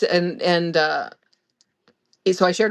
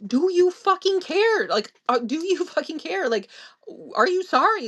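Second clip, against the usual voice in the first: high, exasperated reenacted outburst; retold angry demand; 11 semitones higher than usual. Daughter confronting father over whether he cares, angry demand. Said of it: "screaming at him".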